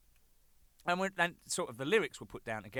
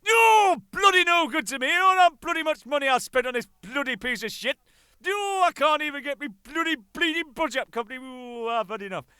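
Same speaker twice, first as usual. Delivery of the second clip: high, loud hammed-up shouting rant; mock furious rant; 13 semitones higher, 13 dB louder than usual. Manager shouting in mock fury at the useless satellite. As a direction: rant like a cheated homesick Yorkshireman. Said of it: "he's pissed off".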